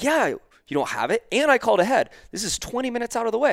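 high-pitched, indignant complaint; upset customer. Retold or imitated. Customer who called ahead complains to worker, upset. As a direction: pile up grievances, pitch climbing sharply.

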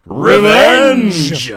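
loud, high cry of vengeance; dramatic revenge shout. Acted. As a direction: bellow it like a comic villain.

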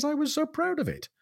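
she's crestfallen, say it high and soft; hurt Mrs Fielding. Acted. Mrs Fielding disappointed, voiced.

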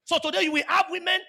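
loud, rising preacher's cry; full-force pulpit preaching; fiery preaching. Acted. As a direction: drive the sermon home with force.

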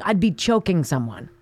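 usual speaking voice

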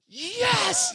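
high, triumphant cheer; pumped. Retold or imitated. Son after his first bird, imitated pumped cry. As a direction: shoot the voice way up in a drawn-out celebration. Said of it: "my son was pumped".

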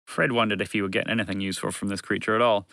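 usual speaking voice

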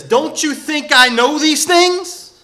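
loud, high, drawn-out sarcastic retort; exasperated, heavily sarcastic Job. Acted. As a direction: throw it back as a mocking question. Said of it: "he is so sarcastic".

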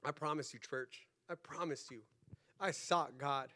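very soft, low and sincere; quiet, solemn assurance; hushed earnest promise. Real, not acted. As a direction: drop right down to confide in the church.

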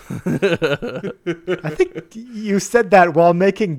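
talking through laughter; laughing. Real, not acted. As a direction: giggle while speaking. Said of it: "Zombie noises".

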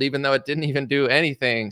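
ordinary talk

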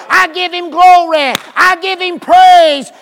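loud praise, full force; preaching shout. Real, not acted. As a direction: lift it up like a shout.

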